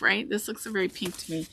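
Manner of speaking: ordinary talk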